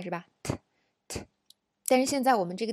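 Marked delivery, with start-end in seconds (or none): 0.4-1.3 soft t, t bursts, slow and quiet; crisp t t demo; popped t. Shown as a demonstration. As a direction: tap out t, t with a clear release. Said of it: "有爆破音出来".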